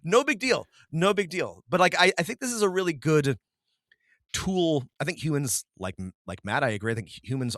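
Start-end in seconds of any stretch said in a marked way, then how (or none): 0.0-2.7 high, light no big deal; breezy dismissal. Retold or imitated. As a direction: brush it off cheerfully. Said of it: "and I'm like".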